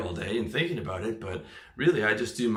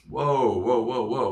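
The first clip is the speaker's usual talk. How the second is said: raised, louder run of whoas, easing off; mock alarmed halt; 2 semitones higher, 6 dB louder, slower than usual. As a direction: rein yourself in with mock alarm. Acting out halting one's own anger.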